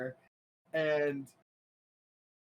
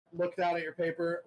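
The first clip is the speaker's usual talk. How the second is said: normal talking voice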